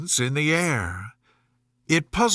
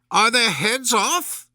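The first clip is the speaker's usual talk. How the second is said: high, slow queenly shout; 8 semitones higher, slower than usual. Acted. Queen checking on the executions, high slow shout. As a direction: demand an answer like a tyrant. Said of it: "shouted the Queen".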